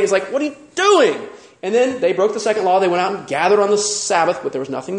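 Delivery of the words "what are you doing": high, exasperated outburst; a mock-angry "What are you doing?"; irate Moses. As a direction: snap at them in disbelief. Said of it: "It says he got irate".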